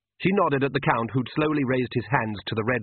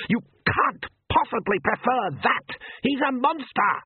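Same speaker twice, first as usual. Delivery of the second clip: a pitched-up outraged count, lightly screamed; mock scream; 12 semitones higher than usual. A narrator's reading. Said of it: "Count Magpier screamed".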